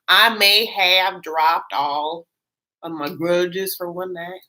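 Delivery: imitating Angela's slow accent, loosely; slow, drawn-out put-on Angela accent; rough Angela accent. Vlogger mimicking Angela's grudge line, rough accent. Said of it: "this is not a perfect Angela accent".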